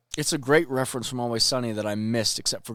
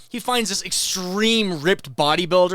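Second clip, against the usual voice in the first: usual speaking voice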